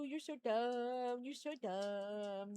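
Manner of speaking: drawn-out tender teasing; slow doting coo. Real, not acted. Owner slowly cooing teasing words to her dog. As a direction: stretch each word fondly to the dog.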